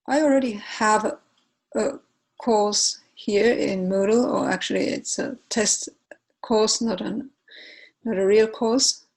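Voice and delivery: ordinary delivery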